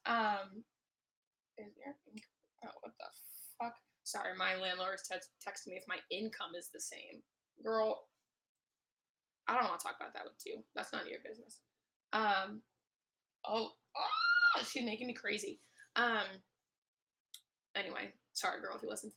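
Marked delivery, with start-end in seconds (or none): 1.6-3.8 real distracted near-whisper, very soft; muttering to herself. Real, not acted. Reading a text from landlord, muttering to herself. 13.5-14.6 very high exasperated squeal. Real, not acted. Podcaster driven crazy by landlord, squealing in exasperation.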